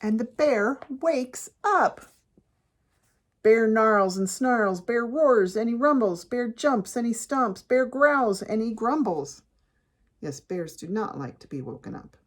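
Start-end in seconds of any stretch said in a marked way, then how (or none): 0.0-9.4 loud, emphatic picture-book reading; dramatic story narration. A narrator's reading. Reader tells kids the bear wakes, dramatic narration. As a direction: punch each beat as the bear storms about.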